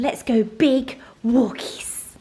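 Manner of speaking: slow, breathy whisper to the dog; coaxing whisper. Real, not acted. To her dog at the door, slow coaxing whisper.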